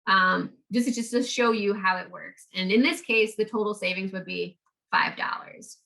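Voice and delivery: usual speaking voice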